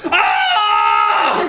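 high loud mock scream; hammed-up burning candle shriek. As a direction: shriek like you're on fire. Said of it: "a bit like".

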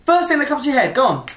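high, loud impatient call; pressing the guest to pick a style; urging. Real, not acted. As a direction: hurry her up. Urging Emma to decide.

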